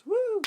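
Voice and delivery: sudden high, loud "woo"; high whoop. Acted. Reviewer mimicking Pennywise suddenly popping up, high loud whoop. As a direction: whoop like a jump-scare sound effect. Sound effect for clown's sudden appearance.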